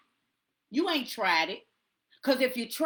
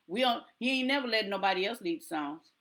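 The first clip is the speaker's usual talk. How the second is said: usual speaking voice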